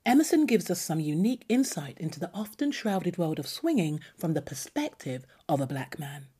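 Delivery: ordinary delivery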